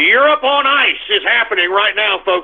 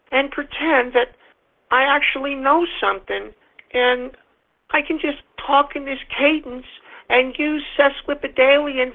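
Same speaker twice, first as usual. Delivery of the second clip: mocking, softer, higher put-on voice of a pompous intellectual; pompous Jordan Peterson; 3 semitones higher, 4 dB quieter than usual. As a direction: sneer through it like a smug professor showing off. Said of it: "I'd like to hear his little pompous ass".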